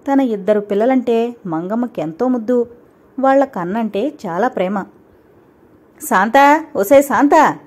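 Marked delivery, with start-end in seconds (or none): 6.1-7.6 calling out. A narrator's reading. Stepmother calls girl from afar.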